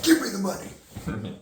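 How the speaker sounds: high, loud robber's demand; acted holdup yell. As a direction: bark it like a movie robber. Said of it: "Pretend you're acting in the scene".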